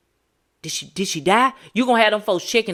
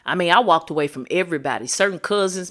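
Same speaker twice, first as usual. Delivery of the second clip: usual speaking voice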